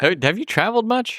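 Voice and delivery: high-pitched doubting question; mock disbelief. Host questioning a fan of St. Catharines, incredulous. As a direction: ask it high, as if baffled.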